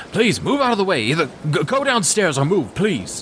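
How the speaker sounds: high-pitched, urgent soldier clearing the way; harried soldier, pitched well up; pleading soldiers. Acted. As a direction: plead with people blocking the stairs. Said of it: "so some soldiers are like".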